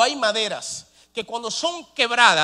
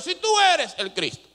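irreverent challenge; 6 semitones higher than usual. Retold or imitated. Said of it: "como si fuera un empleador".

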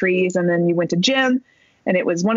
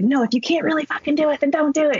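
high, heated snap at a rival; mock angry retort, pitched high; retold yell; 8 semitones higher than usual. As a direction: imitate someone scolding a teammate. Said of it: "people were yelling at each other".

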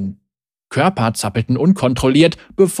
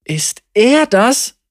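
startled thug; 8 semitones higher, much slower than usual. A narrator's reading. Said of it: "fragte ein Schläger".

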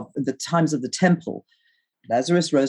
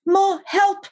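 very high cry to mother; high-pitched, desperate call; yell for help. A narrator's reading. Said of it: "I yelled".

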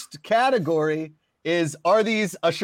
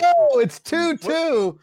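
excited score call; 9 semitones higher, 3 dB louder, slower than usual. Real, not acted. Host announces tied score.

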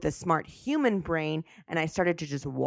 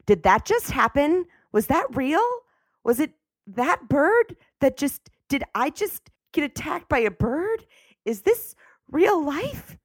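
disbelieving; 8 semitones higher than usual. Retold or imitated. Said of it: "when I had asked them".

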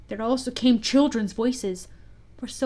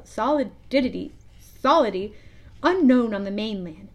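ordinary delivery

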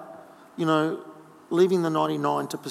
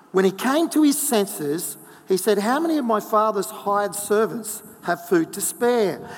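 raised, earnest Bible reading voice; scripture reading; 4 semitones higher than usual. Acted. Pastor reading the lost son parable to the congregation, earnest reading. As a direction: read the parable as if living it.

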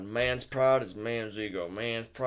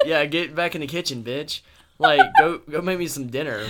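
raised, louder joking command; mock bossy order; 4 semitones higher, 7 dB louder than usual. Parodying sexist men, mock bossy order. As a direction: bark a sexist order in jest.

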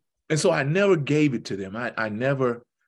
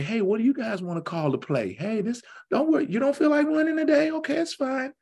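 lightly voiced gentle coach, higher and fast; nice coach voice; 12 semitones higher, faster than usual. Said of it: "nice Coach Malone would say".

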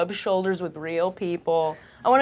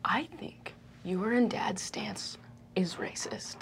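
whispered accusation; 10 dB quieter than usual. Acted. Daughter accuses her parents.

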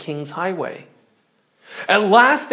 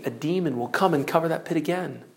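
usual speaking voice

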